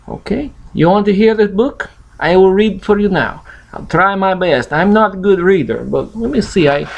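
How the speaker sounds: plain reading voice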